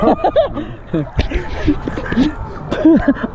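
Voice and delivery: high breathless laughing. Real, not acted. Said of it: "I put every ounce of rage into that".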